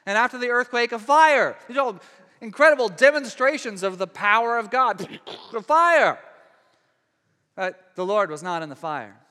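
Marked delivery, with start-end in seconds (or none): 0.1-6.2 high, loud storytelling climax; dramatic climax. Retold or imitated. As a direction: punch out the fire, building to a peak. Dramatizing fire, God's power.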